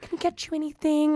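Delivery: put-on high, soft, condescending baby voice. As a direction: talk to him like a toddler. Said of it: "talk to my dad in a wheelchair and a baby voice".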